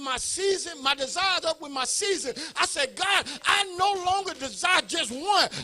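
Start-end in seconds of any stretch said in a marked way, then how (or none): 3.0-5.5 a father begging God to save his preemie babies, loud and fervent; fervent loud prayer. Real, not acted. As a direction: cry out to God, high and loud. Prays aloud to God.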